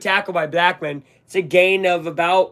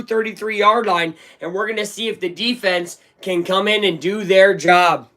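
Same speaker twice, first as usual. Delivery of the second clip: raised, quick play-by-play call; excited fan announcing the punt return; live play-by-play; 5 semitones higher than usual. Acted. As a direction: call the return as it unfolds, pitch lifted.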